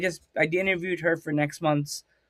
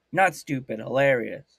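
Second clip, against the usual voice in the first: ordinary talk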